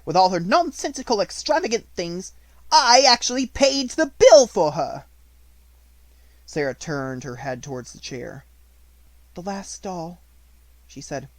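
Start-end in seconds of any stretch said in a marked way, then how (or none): 0.1-5.1 far louder, higher rant; furious shout. A narrator's reading. Minchin's outburst continues. 9.4-10.2 the orphan looking at her last doll; mournful. A narrator's reading. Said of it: "little mournful voice".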